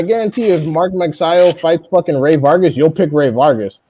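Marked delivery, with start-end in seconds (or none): none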